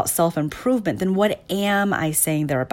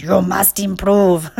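louder, mock-stern German accent; 5 dB louder than usual. Inner critic ordering the speaker around, in a hammed-up German accent. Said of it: "my inner critic must have a German accent".

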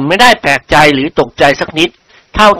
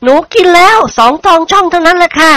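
put-on girl calling out; 13 semitones higher than usual. A narrator's reading. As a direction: call out like a young daughter. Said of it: "ร้องขึ้น". (เสียงเด็กสาวร้องขึ้น)

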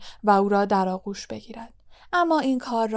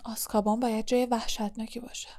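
muttered, much softer; a soft muttered line; under his breath; 8 dB quieter, faster than usual. A narrator's reading. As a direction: mutter it half to yourself. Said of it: "زیر لب گفت".

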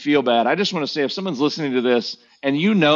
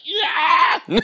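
high, drawn-out cheer of a congregation; crowd cheer; 13 semitones higher than usual. Retold or imitated. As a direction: mimic a crowd erupting in a long, high yeah.

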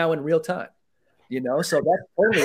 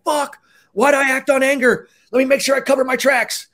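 mock panicked regret; 9 semitones higher, 6 dB louder than usual.

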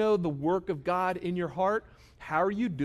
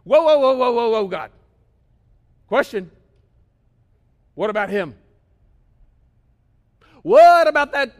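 high, loud complaining objections; loud protest to God; 7 semitones higher, 13 dB louder than usual. Retold or imitated. Preacher acting himself objecting to God, loud and high. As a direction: push back at God like a petulant kid.

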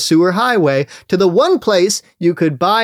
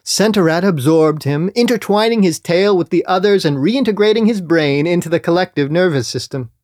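plain reading voice

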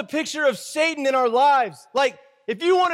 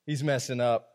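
low, soft sneering aside; mock Saul; 11 semitones lower, 7 dB quieter than usual. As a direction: Saul muttering about David's sin, lower and softer. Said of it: "He's like".

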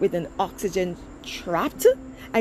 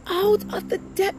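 much higher, ringing call of the verse; preaching cry; 10 semitones higher than usual. Acted. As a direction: lift the words up like a plea. Preacher repeats verse, raised.

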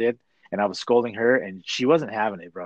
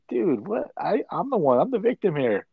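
indignant protest; 7 semitones higher than usual. Retold or imitated. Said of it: "I'm just like".

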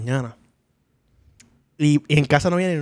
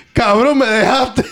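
slow, laughing through the words; retelling the bleeding brother's complaint, laughing slowly; laughing quote; 7 semitones higher, 4 dB louder, much slower than usual. Retold or imitated. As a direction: quote the brother while cracking up.

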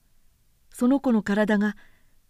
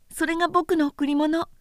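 put-on a lightly higher boyish voice, tender and earnest; little prince voice; 8 semitones higher than usual. Small prince consoling the pilot, lightly higher boyish voice. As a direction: speak like a small boy comforting a friend.

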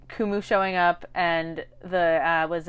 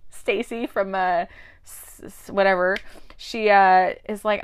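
ordinary talk

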